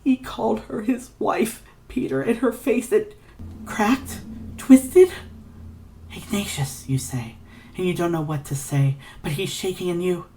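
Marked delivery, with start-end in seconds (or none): 0.0-5.1 high, strained and cracking, ending in a sharp high cry; shattering voice. Acted. Ignatius recalling his father's wife, voice shattering. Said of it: "his voice breaks, shatters like a splintering". 6.1-6.9 a soft whispered name. Acted. Comforting a shaking Ignatius in a whisper. Narrator unsure how to comfort him.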